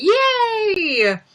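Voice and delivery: long, high drawn-out squeal; excited cheer. Real, not acted. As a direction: celebrate with a high-pitched yay. Cheering over her costume.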